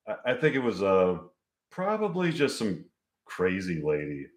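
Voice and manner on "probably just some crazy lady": plain reading voice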